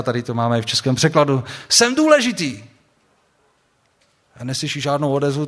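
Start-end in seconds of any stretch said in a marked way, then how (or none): 1.7-2.6 a high cry thrown up at the starry sky; mock yell. Calvin yells at the starry sky, a high mock shout. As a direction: play Calvin yelling his importance into the void. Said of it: "řve do světa".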